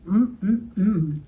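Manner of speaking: greedy yum-yum hum; hungry mmm. A narrator's reading. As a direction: hum like tasting a meal. Troll savoring thought of food.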